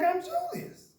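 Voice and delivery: high, chipper introduction; high proud introduction. Retold or imitated. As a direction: announce your name brightly up high. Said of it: "I'm like".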